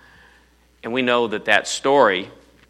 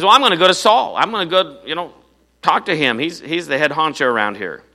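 eager; 7 semitones higher, 7 dB louder than usual. Acted. David eager to see Saul.

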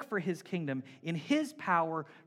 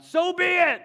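much higher and louder sudden yell; 12 semitones higher, 13 dB louder than usual. Retold or imitated. Cheryl yelling out mid-service, loud high yell. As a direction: blurt it out across church. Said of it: "if Cheryl started yelling out".

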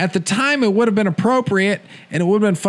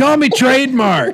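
laughing; 8 semitones higher, 4 dB louder than usual. Real, not acted. Laughing joke line.

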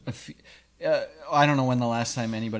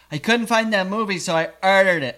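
high, loud mimicking voice; put-on voice; 6 semitones higher, 6 dB louder than usual. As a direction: imitate her in a silly raised pitch. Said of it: "I don't know why I'm doing that voice".

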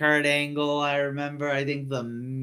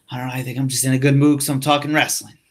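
ordinary talk

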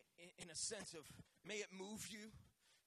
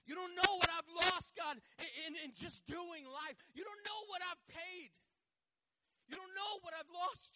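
loud, higher lament to God; anguished complaint; 11 semitones higher, 7 dB louder than usual. Retold or imitated. Preacher voicing a believer shouting losses to God. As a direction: cry out your losses to God.

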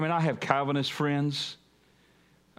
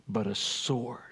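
softer, whispered punchline; dramatic whisper; 6 semitones lower, 5 dB quieter, slower than usual. Acted. Pastor quoting Jesus to the church, dramatic whisper. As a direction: breathe the words out like a secret.

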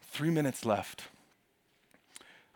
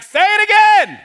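high, loud preacher's call; Jesus as a preacher urging her, loud; Pentecostal preacher; 20 dB louder than usual. Acted. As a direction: egg the crowd on like a revival preacher. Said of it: "Jesus is kind of a Pentecostal preacher".

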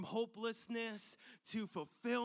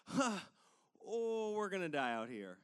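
not funny anymore. Retold or imitated. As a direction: let the dread land, voice dropping. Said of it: "it's, like, not funny anymore".

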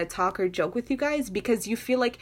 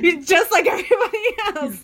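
laughing; 9 semitones higher, 7 dB louder than usual. Retold or imitated. Mock betrayal, breaking into laughs.

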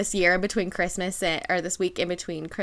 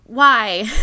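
a sudden loud, fed-up "Why?"; loud exasperated why; 2 semitones higher, 11 dB louder than usual. Retold or imitated. Asking why the hard year happened, loud and fed up. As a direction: throw the word out like a groan of frustration. Mocking the useless why question.

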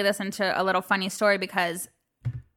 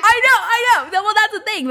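laughing agreement; 13 semitones higher, 9 dB louder than usual. Real, not acted.

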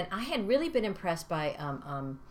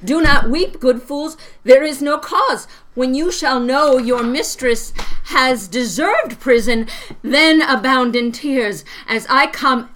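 louder, higher, straining through the speech; pained queen's plea; 4 semitones higher, 11 dB louder than usual. Shown as a demonstration. Mother acting Hermione's plea while in labor, strained and loud. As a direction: push the lines out through labor pains. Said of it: "She's going into labor".

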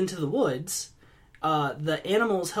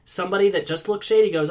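ordinary delivery

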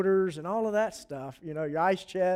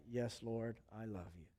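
humble confession; 10 semitones lower, 16 dB quieter than usual. Acted. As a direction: barely breathe it out, heartfelt. Peter answers again.

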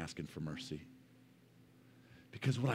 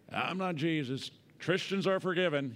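mocking; 6 semitones higher than usual. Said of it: "You like that bump sticker?".

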